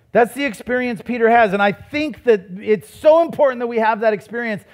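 high, driving pulpit delivery; impassioned preaching. Real, not acted. Preacher urging congregation to see God's greatness, impassioned preaching. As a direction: preach it hard, pitch held high.

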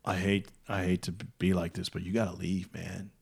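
lightly voiced stoner, low and soft; Spicoli. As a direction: mumble it like an apologetic stoner. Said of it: "imagine a Spicoli voice".

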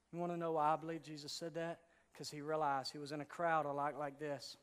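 usual speaking voice

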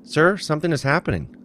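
a frightened crewman calling out to the captain; scared crewman raising the alarm; fearful. A narrator's reading. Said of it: "with fear in his voice".

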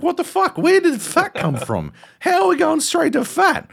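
very high, laughing disbelief; half-laughing, baffled and offended; mock indignation. In his head about the fat jab, high laughing disbelief. Inner reaction to fat insult.

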